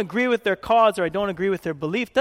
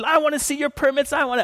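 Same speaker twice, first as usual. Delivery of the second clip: complaining neighbor; 7 semitones higher than usual. Retold or imitated. Neighbors phoning to demand permits, high demanding voice. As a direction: whine like an irate caller.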